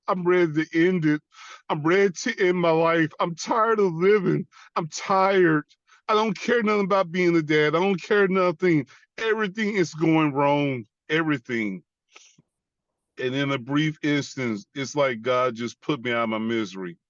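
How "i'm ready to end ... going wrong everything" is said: high, forceful anguish, easing at the very end; despairing outburst. Retold or imitated. Veteran telling God he is tired of living, despairing outburst. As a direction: pour out exhaustion in a raised voice.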